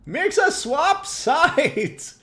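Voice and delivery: high, loud urgent shouting. Real, not acted. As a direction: yell at a teammate to hurry. Urging teammate to swap.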